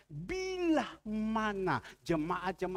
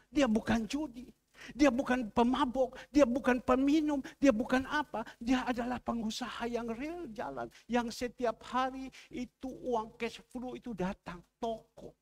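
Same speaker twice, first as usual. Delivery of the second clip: raised, loud opening in insistent repeated denials; forceful preaching; 7 semitones higher, 5 dB louder than usual. Acted. Preacher defending a struggling businessman to the congregation, forceful preaching. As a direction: preach it high and emphatic.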